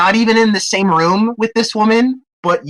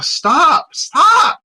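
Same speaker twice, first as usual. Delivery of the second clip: shouting; 11 semitones higher than usual. Real, not acted. Disgusted at gross feet.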